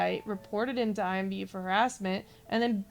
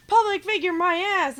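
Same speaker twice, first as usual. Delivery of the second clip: sneering mock taunt, higher and louder; mocking troll quote; 7 semitones higher, 7 dB louder than usual. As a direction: throw his insult back with a jeer.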